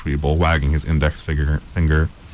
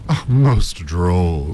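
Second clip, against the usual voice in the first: slow amused drawl; much slower than usual. A narrator's reading. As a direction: savour the joke slowly. Professor amused.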